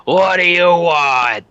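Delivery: loud, slow, high gruff slob voice; grumpy slob. Acted. Lazy boss answering the clerk's call, loud slow grumble. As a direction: bark it out like an annoyed slob. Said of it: "slovenly asshole walks up".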